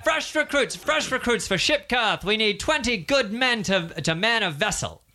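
high-pitched recruiting cry; recruiter calling out. Acted. Ship recruiter to the crowd, high hawker call. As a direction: shout for sailors across the square. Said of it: "hear someone yelling out".

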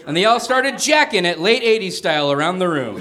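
ordinary delivery

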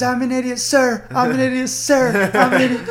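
high, loud recruit yelling; shouting recruit. Retold or imitated. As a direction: bark it out over and over. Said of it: "Say, "I'm an idiot" ten times".

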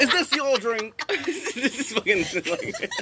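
high, slow, laughing mock Cosby voice; a joking Bill Cosby imitation that breaks into laughter; Bill Cosby impression. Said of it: "do Bill Cosby impressions".

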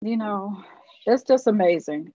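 fighting back tears through a broken laugh; choked up. Real, not acted. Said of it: "I get a little bit emotional sometimes".

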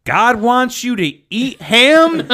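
slow, high disbelieving question; incredulous sister. Retold or imitated. Sister reading the ham line aloud, slow and incredulous. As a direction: drag the words out in shocked disbelief.

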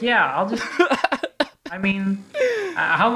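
laughing through the words; giggling mid-sentence. Pretend. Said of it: "and you're like".

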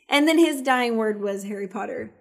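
ordinary delivery

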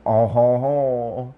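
hammed-up French chortle; French accent. Shown as a demonstration. Asked by a friend for French, mock French accent. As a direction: put on a stagey French laugh. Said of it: "let me hear a French accent".

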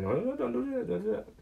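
high, frantic no no no; a high-pitched alarmed Wonka stopping a kid; panicked Wonka. Retold or imitated. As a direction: squeal it like a fussy candy man.

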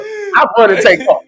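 usual speaking voice

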